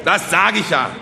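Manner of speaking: loud, high, triumphant agreement; emphatic orator. Acted. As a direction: seize the point and harangue the crowd. Orator seizes point, addresses crowd.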